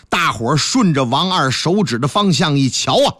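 normal talking voice

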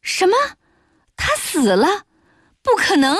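high-pitched, put-on shock; feigned surprise. A narrator's reading. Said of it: "我故作惊讶地立起身". (故作惊讶，高声不敢相信)

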